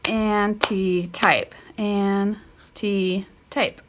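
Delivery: "anti-type" drawn out slowly, each syllable apart; slow sounding out. Shown as a demonstration. Teacher sounding out "anti-type" slowly for the class. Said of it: "sounding out slowly for kids".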